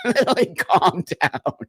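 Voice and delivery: chuckling, telling herself to calm down; giggling self-reminder to calm down; laughing at self. Real, not acted. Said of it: "I get very heated".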